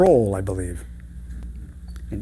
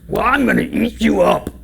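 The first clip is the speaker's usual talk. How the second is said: raised, growled troll threat; menacing troll; 9 semitones higher, 4 dB louder than usual. Acted. Troll threatening to eat little billy goat, hammed-up menace. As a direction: play a storybook monster threatening its meal.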